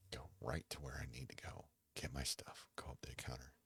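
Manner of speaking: hushed whisper, sneaking through the store; soft stealthy whisper; whispered errand. Retold or imitated. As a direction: whisper it like slipping in unnoticed. Describing quiet in-and-out errand.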